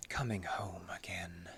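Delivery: a hushed, rapt whisper as if arriving home; whispered homecoming; dreamy whisper. Acted. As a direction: breathe it out in a whisper, homesick. Eerie visitor at the old cabin.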